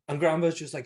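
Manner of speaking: normal talking voice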